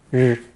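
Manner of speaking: 日 as a lone curled-tongue R sound; retroflex R sound. Shown as a demonstration. Teacher demonstrating the Chinese R ending, retroflex 日. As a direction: say 日 with the tongue curled back, as a bare R. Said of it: "a Chinese retroflex R sound".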